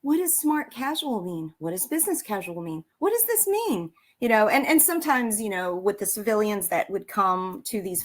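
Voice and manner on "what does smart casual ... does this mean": bewildered questioning. Retold or imitated. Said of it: "like, going".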